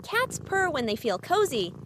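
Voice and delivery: usual speaking voice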